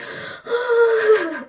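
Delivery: high, drawn-out gasping cry; hammed-up gasp of agony; imitated pain gasp. Woman reenacting being sprawled in the back seat, mock pain cry. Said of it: "screaming in pain".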